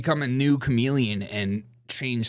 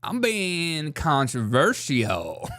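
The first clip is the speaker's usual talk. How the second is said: slow mock sass; much slower than usual. Repeat, slow and drawn out.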